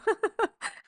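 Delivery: a short high teary laugh breaking through; tearful laugh. Real, not acted. Speaker admits to viewers she's getting emotional, teary laugh. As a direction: laugh through welling tears. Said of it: "I am getting emotional right now".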